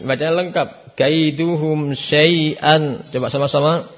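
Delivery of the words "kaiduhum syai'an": slow full reading. Shown as a demonstration. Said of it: "ada yang baca syai'a".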